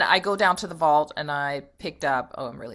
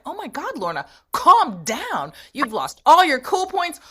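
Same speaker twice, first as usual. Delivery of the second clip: scolding herself; 8 semitones higher, 5 dB louder than usual. Retold or imitated. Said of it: "I was like".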